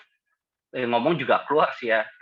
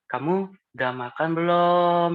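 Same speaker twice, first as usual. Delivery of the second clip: higher, slowed, sweetly caring; gentle soft-spoken voice; 5 semitones higher, slower than usual. Shown as a demonstration. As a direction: ask it like a gentle caring friend. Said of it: "suaranya halus gitu".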